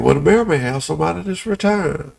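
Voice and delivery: ordinary delivery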